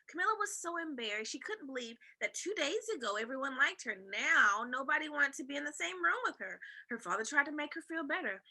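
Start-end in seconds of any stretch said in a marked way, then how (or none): none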